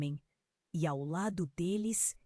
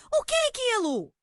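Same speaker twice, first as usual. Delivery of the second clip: high-pitched, louder cry of demand; grito; 14 semitones higher, 5 dB louder than usual. A narrator's reading. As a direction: shout the question far above usual pitch. (pergunta gritada, bem aguda)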